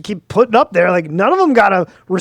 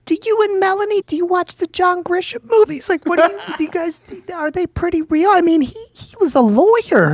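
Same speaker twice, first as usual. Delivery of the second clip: much higher, eager mom asking questions; imitating a mom who wants to know if the movies are real; 11 semitones higher than usual. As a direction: play his mother, full of curious questions. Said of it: "in full mom fashion".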